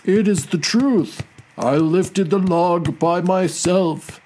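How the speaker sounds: slow, high, boastful buffalo voice; proud storybook buffalo; proudly. A narrator's reading. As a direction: declare it with pride, drawing out each word. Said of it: "replied the buffalo proudly".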